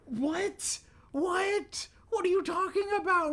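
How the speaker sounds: very high, loud disbelieving what; baffled outburst. Real, not acted. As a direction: shriek of confusion at the scanner. Game beeps confuse her.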